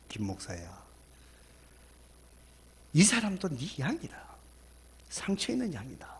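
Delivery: soft, whispered divine rebuke; hushed rebuke. Retold or imitated. The Holy Spirit softly rebuking the pastor in a whisper. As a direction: speak as the Spirit's quiet reproach. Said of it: "성령님이 저를 막 책망하시더라고요".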